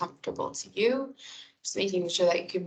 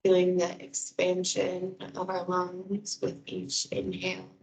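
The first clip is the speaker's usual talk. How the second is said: guided meditation. Real, not acted. Wellness coordinator guiding listeners through breathing and muscle release, guided meditation.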